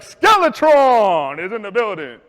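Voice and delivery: loud, slow hype-man call; mock announcer. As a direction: announce him like a wrestler entering. Bullies announce skinny kid.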